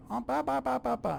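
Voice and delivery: very high-pitched babble imitating mom; mock chattering. Wife who can't help herself talking on, mock high babble. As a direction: rattle off nonsense syllables way up high.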